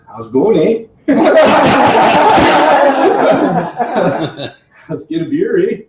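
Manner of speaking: imitating a Canadian accent with a rising eh. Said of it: "you think Texas accent when you see him".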